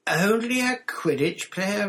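high, loud, drawn-out disbelief; incredulous. A narrator's reading. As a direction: echo it back, loud and scandalised. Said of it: "as though he couldn't believe his ears".

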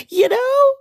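high laughing you know. Real, not acted. Joking about bougie dogs, laughing high. Laughing at her own joke.

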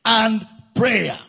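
very slow, word-by-word pulpit emphasis; drawn-out, deliberate preaching; emphatic preaching. Acted. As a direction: stretch and weigh each word for the congregation.

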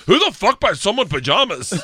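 loud, high-pitched outburst, incredulous; mock-outraged heckle from a drinking dad; indignant dad. Dad drinking whiskey at Christmas reacts to the gift, loud indignation.

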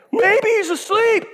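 high, slow jeering line; mocking taunt. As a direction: drag out the mockery. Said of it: "he's so taunting them".

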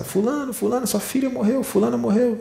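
high, panicked cries calling through the house; a relative's high alarmed shouting at discovering the death; frantic yelling. Retold or imitated. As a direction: yell for the family in high-pitched shock. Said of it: "Vai gritando pela casa".

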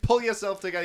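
high, loud mock dismissal; Gandalf, exasperated. Podcaster retells the wizard silencing the king, mock exasperation. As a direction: snap it as an imitated fed-up commander. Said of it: "And then Gandalf just comes and".

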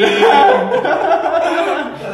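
high, loud burst of laughter; big laughing fit. Real, not acted. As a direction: laugh out hard at the joke. Group laughs at the story.

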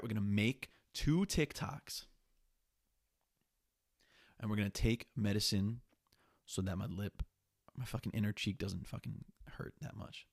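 normal talking voice

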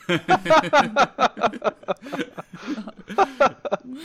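big loud laugh. Real, not acted. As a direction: burst out laughing. Laughing at robot bit.

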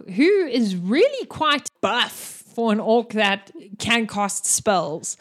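usual speaking voice

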